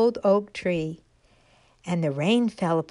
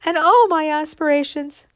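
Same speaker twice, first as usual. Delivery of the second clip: high, louder, hurried lament; frightened despair; 7 semitones higher, 5 dB louder than usual. Acted. Fallen acorn lamenting in fright on the ground. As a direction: wail about being lost and useless. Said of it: "said the frightened little thing".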